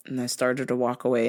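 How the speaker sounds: normal talking voice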